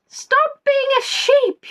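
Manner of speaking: high squeaky telling-off, slow; high mock scold. Real, not acted. Host teasing a player for bleating, high squeak.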